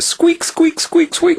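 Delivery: tiny high squeaking like a mouse; mouse squeaks. Retold or imitated.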